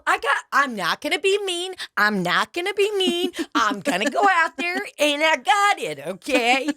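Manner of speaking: high, breezy mock calm; reassuring herself. [name] assuring coworker she has it handled, high mock calm.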